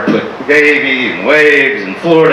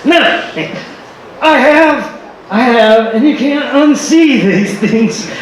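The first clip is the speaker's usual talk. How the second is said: excited disbelief; 8 semitones higher than usual. Real, not acted. Shocked confession.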